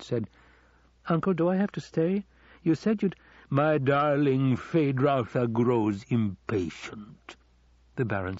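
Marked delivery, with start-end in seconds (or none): 1.1-3.2 high, youthful whine to an uncle; much higher voice, pleading to leave; impatient nephew. Acted. Impatient nephew interrupts his uncle. 3.5-7.4 slow, louder start, drawn-out villain drawl; indulgent mockery. Baron mocks his impatient nephew.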